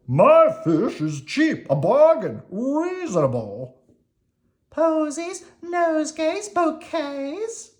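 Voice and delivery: a chimney sweep, tailor, fishmonger and flower seller calling out to passers-by; street hawkers. Acted. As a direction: sell it loud like a London market seller. Street vendors calling their wares.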